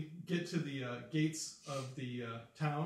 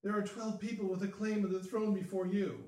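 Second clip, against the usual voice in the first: high-pitched, aghast protest; dying Baroness; 6 semitones higher than usual. Acted. Stabbed Baroness to her usurping cousin, high aghast voice. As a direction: play her appalled disbelief in a raised pitch.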